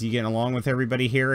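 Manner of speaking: fast, light fatherly question; quick fatherly check. Acted. Kyle checking on Sarah, fast and fatherly. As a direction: rattle it off kindly. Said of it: "And he says".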